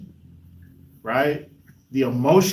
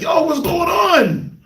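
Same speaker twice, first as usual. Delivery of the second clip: high, drawn-out mock-panicked shout; retold alarm; 13 semitones higher, 4 dB louder, much slower than usual. Driving parent to wailing child in back seat, alarmed. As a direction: call out in exaggerated alarm, stretching it.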